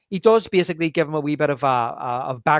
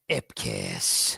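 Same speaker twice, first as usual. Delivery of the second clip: deep low growl of a name; gruff detective growl; 6 dB quieter than usual. Retold or imitated. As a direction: drop way down and snarl it. Imitating Kellaway growling name.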